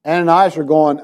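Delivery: normal talking voice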